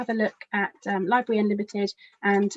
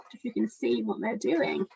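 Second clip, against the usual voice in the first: ordinary delivery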